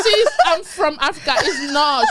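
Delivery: loud, high-pitched protest; arguing back. Retold or imitated. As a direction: retell an argument, pushing back hard. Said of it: "She was arguing with me".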